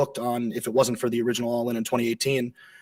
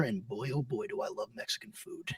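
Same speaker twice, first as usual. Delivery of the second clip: much softer, whispered, swooning over the food; dreamy whisper; 4 semitones lower, 16 dB quieter than usual. Acted. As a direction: sigh it out like a guilty pleasure. Gushing about Mexican food.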